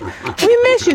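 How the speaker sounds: high cutesy goat voice; voicing the goats. Speaking for goats at the fence in high voice. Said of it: "They're just like".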